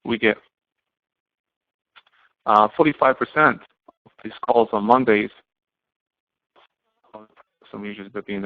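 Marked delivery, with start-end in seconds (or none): none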